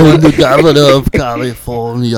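booming mock Austrian accent; hammed-up Austrian impression, very loud; Arnold. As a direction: announce it loudly as the action star. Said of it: "[name] has the best Arnold".

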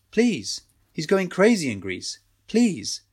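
high, desperate plea; pleading. Acted. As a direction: beg him to help. Azad begs for his brother.

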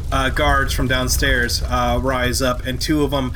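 ordinary delivery

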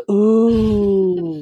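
sharp gasp, then long high ooh; crowd's shocked ooh. Retold or imitated. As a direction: imitate onlookers reacting to a takedown. Imitates everyone's shocked reaction.

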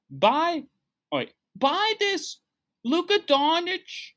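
high-pitched, slow, pushy hustler impression; Gary V. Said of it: "That's what Gary Vee sounds like to me".

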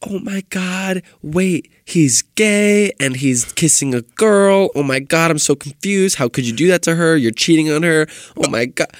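put-on high, loud screaming teen fan; excited fangirl. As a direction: gush like an excited young fan. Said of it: "They're like".

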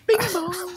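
high sung two-note ringtone; mock phone ring. Player mimicking a phone ringing with a high two-note chime.

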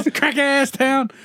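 high, slow mock rant; mock outrage. Imitating the reporter cursing the town, high mock outrage. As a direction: squeal it out as a disgusted reporter. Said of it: "totally got rid of news voice".

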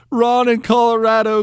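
normal talking voice

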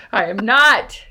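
ordinary delivery

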